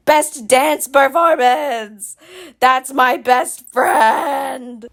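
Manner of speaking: slow, drawn-out proud exclamation; proud cheer. Real, not acted. Proud of best friend's win, drawn-out excited cheer. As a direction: stretch it out with pride.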